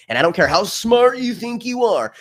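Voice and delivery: loud, high-pitched scorn; scornful rant. Real, not acted. Ranting at people who think they're smart.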